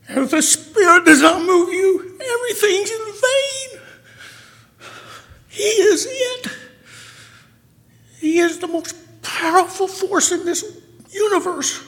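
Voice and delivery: high, tearful voice breaking while preaching; emotional crying. Real, not acted. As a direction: cry through the words, pleading. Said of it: "I'm sorry I'm so, so emotional".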